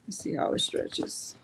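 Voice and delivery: soft breathy whisper, no voice. Shown as a demonstration. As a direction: hush it like a secret before the test. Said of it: "repeat as whisper".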